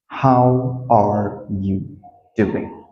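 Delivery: very slow, word by word; slow, careful teaching demo; slow model. Shown as a demonstration. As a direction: leave space between the words. Teacher models question slowly.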